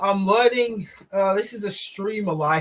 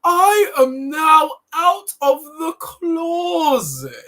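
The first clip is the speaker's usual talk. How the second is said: loud, very high, drawn-out mock voice; Vegan Gays; 11 semitones higher, 6 dB louder, much slower than usual. Streamer making fun of how she says closet, high loud drawn-out impression. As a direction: slowly caricature the activist, exaggerating the word closet. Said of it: "the way she says the word closet".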